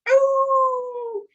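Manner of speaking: long high dog howl; aullido de perro. Acted. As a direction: howl like a hurting little dog. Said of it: "Porque era un aullido". (aullido agudo y largo de perro adolorido)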